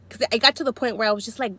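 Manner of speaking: usual speaking voice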